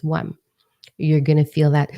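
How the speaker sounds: ordinary delivery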